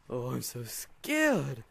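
slow, loud, mocking fake fright; sarcastic mock fear. Taunting a chasing player, sarcastic fake fright. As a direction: pretend to be scared, dripping sarcasm.

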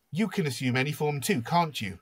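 a touch of tetchy accusation, acted; snapping. Lissa challenges the shapeshifting Beast, mildly snappish. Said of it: "she snaps".

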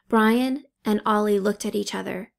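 ordinary delivery

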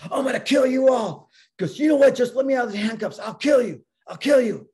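loud, high angry shouting; yelled threats. Retold or imitated. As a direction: yell threats as a raging handcuffed patient. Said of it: "he's yelling at me, yelling at my crew".

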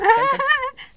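high loud burst of laughter; bright laugh. Real, not acted. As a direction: laugh out at the joke. Co-host laughs at joke.

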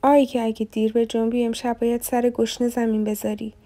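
ordinary delivery

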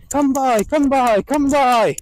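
bright herding call; called dog command. Retold or imitated. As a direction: call it out across the field. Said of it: "I was going".

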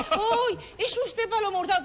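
high, slow delighted fan voice; gushing nurse. Retold or imitated. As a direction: gush as if star-struck. Nurse recognizing celebrity.

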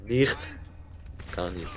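usual speaking voice

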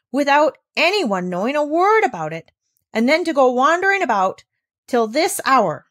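stern scolding. Acted. Governess scolding the girl for running off, stern and rising. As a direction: scold the child, rising in anger. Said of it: "said sternly".

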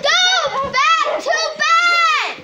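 loud, high, drawn-out yell; shouted order. Real, not acted. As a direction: yell it slowly, stretching each word. Ordering children back to bed.

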